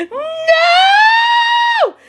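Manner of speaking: very high, long screeched no; mock scream. Guest refusing to ever return, hammed-up high scream. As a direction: shriek it out and hold it. Acted refusal after invitation.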